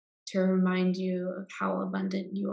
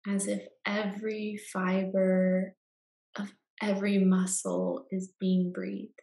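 guided meditation. Acted. Guide leading listeners into Yoga Nidra rest, calm meditation voice.

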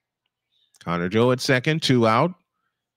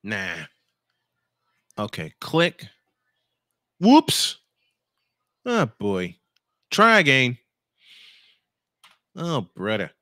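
lightly voiced cartoon rabbit; a goofy nod to the old cartoon; a hint of a cartoon rabbit voice; Bugs Bunny imitation. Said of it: "that was an imitation Bugs Bunny voice".

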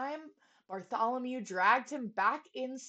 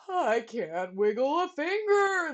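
loud, slow wail; 5 semitones higher, 7 dB louder than usual. A narrator's reading. Trumpeter stuck to his horn, wailing. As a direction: wail it in despair. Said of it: "the trumpeter wailed".

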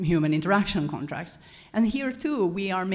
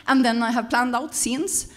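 put-on higher-pitched Swedish-accented delivery; 6 semitones higher, 3 dB louder than usual. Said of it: "I did that in a Swedish accent".

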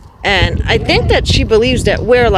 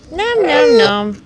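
high coaxing nom; 7 semitones higher, slower than usual. Real, not acted. Coaxing goose with eating noise.

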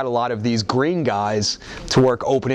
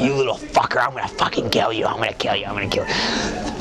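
seething threat; 3 semitones lower than usual. Retold or imitated. Furious Brock threatening Spike in the ring, seething. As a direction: threaten through your teeth, fading to a hiss. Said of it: "just going".

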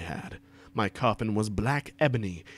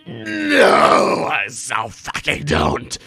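shouted; 8 dB louder, much slower than usual. A narrator's reading. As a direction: shriek the no, then force out the rest. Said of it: "I shouted".

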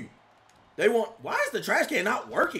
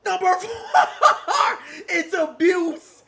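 laughing hype; 7 semitones higher, 6 dB louder than usual. Real, not acted. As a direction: shout it through real laughter.

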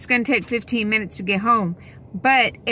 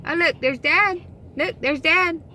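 much higher, bright call to the puppy; excited to dog; 7 semitones higher than usual. Real, not acted. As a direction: point Dad out to the dog. Excited telling dog about Dad.

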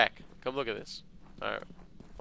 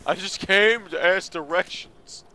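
silly character voice; 8 semitones higher, 7 dB louder, slower than usual. Pretend.

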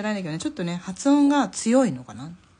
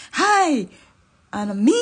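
loud, high "me too" from the back; high, punchy mock enthusiasm; retold eager chime-in; 7 semitones higher, 9 dB louder, slower than usual. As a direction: jump in loudly, imitating an eager volunteer. Retold group chiming in from behind.

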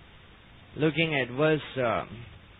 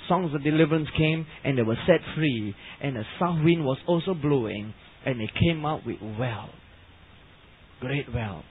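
usual speaking voice

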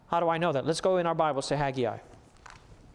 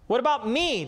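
"me" set against "you", higher pitch; pointed higher question with weight on "me"; stress on me; 6 semitones higher than usual. Shown as a demonstration. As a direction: challenge them, leaning on "me". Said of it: "That's the emphasis".

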